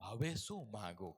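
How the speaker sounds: soft unvoiced question; unsure believer whispering; whispered wondering. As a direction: whisper it to yourself. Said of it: "લોકો વિચારે".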